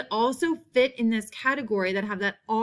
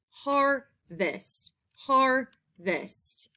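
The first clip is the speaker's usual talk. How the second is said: "har... vest", a pause between the halves; syllable by syllable; 3 semitones higher, much slower than usual. Shown as a demonstration. Teacher sounding out the next word, "Harvest" split in two. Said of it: "in the first syllable".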